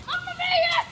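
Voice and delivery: high excited Mamma mia exclamation. Real, not acted. Repeated, higher and louder.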